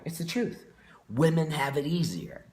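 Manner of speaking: retold, openly stated and insistent; defiant repeat. One of the guys repeating his remark to the group, defiant. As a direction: say it out to the whole room, unapologetic. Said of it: "do you mind saying that again".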